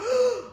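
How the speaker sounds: sharp high gasp with a laugh; loud, high startled intake of breath; mock gasp. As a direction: gasp in hammed-up shock. Retold fear of losing him.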